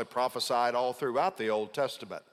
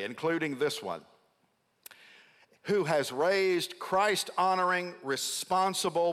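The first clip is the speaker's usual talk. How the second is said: ordinary talk, nothing marked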